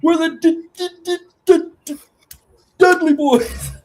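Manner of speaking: high, stuttering D-D-D; stuttering wrestler. Retold or imitated. Mimicking a stuttering wrestler's promo, high and stammering. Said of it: "don't forget the stutter".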